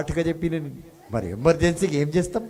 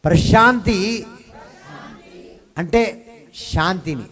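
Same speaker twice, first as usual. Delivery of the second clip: high, loud, slow pulpit emphasis; fiery preacher pounding the scripture; preaching at full force; 6 semitones higher, 5 dB louder, slower than usual. Acted. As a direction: hammer each word of the verse. Preacher drives the verse home.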